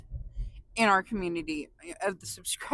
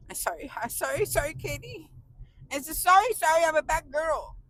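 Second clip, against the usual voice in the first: playful sorry; 8 semitones higher than usual. Pretend. Playful apology to Katie.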